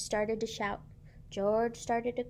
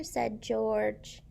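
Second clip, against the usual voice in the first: plain reading voice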